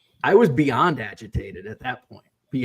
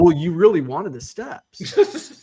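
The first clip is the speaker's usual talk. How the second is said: slow, higher, louder mimicry of the contractor; mimicking pushback; 5 semitones higher, 6 dB louder, slower than usual. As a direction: imitate him pushing back, drawn out. Mimics contractor pushing back.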